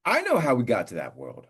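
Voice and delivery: high, loud comic exasperation; exasperated rant. Real, not acted. Host griping about spam loan calls, exasperated rant.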